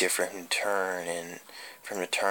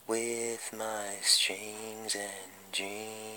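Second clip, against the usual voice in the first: higher, slow, soft and eerie; eerie puppeteer; 4 semitones higher, 9 dB quieter, much slower than usual. Acted. The puppeteer lures a lonely victim, slow and softly eerie. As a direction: draw each word out like a creepy puppet master.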